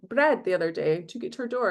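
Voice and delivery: usual speaking voice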